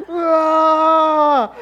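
loud high raging yell. Retold or imitated. Husband yelling in mock rage over the phone call. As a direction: bellow like you're bursting out of your clothes. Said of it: "I was turning green".

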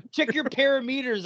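"parameters" said where perimeter was meant, mock pompous; mispronounced parameters. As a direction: quote "parameters" high and smug. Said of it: "he says parameters".

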